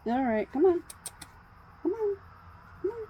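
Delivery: high pleading come on; coaxing the cat. Real, not acted. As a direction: lure the cat gently.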